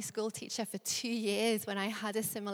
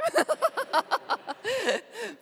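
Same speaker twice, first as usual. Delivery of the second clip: big laugh; 11 semitones higher, 7 dB louder than usual. Real, not acted. Laugh after own joke.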